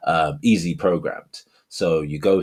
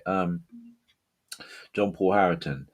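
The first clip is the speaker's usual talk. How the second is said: normal talking voice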